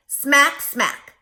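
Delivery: smack smack, first one loud; loud lip smacks. Shown as a demonstration. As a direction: smack the lips like a hungry fox. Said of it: "lick your chops".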